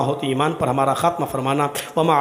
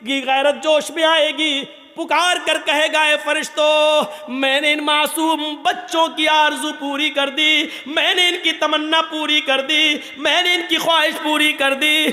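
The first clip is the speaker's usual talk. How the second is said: impassioned, raised sermon voice, loud; fiery storytelling sermon to the congregation; preaching at full force; 11 semitones higher, 5 dB louder than usual. Acted. Preacher tells the Judgement story.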